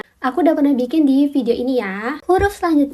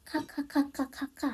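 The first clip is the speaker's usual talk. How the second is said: soft fading "K, K, K" like typed laughter; breathy kkk chuckle trailing off; quiet ke-ke-ke laugh; mock laugh kkk; 15 dB quieter, much faster than usual. Shown as a demonstration. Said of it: "Yang sering buat simbol orang ketawa".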